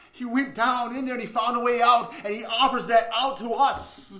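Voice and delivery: loud, high proclamation; impassioned preaching. Real, not acted. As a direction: drive the point home at full preaching force. Preacher's climax of the parable.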